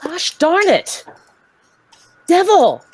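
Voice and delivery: exaggerated preacher voice. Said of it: "his voice would go from".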